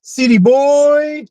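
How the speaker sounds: put-on very high, slow, drawn-out city boy; mock the insult in a squeaky put-on voice; mocking squeal. User parroting the AI's insult, very high and slow.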